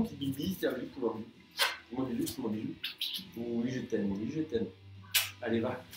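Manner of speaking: soft, low cooing; tender pet talk to the dog. Real, not acted. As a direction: whisper sweet nothings to a pet.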